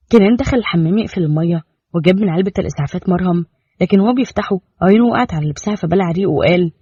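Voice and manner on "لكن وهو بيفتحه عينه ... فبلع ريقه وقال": usual speaking voice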